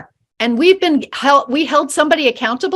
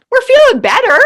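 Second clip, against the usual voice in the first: excited; 10 semitones higher, 5 dB louder than usual. Real, not acted. Joyful emphasis.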